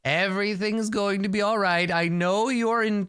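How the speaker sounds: exaggerated reassurance. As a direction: coo comfort at the patient, high-pitched. Continued put-on bedside comfort.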